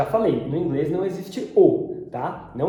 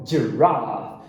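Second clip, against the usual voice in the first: giraffe with a long British ah; low British giraffe, back A; British accent; 6 semitones lower than usual. Shown as a demonstration. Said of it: "Britânico".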